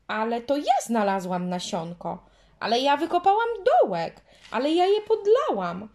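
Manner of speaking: put-on squabbling girl, high and loud; quarrelling. A narrator's reading. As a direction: shout your claims at her. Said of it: "Dziewczynki tak się kłóciły".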